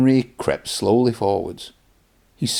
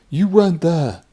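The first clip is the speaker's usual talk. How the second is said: with a shiver; 8 semitones higher, 3 dB louder than usual. Acted. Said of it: "Henry puffed with a shiver".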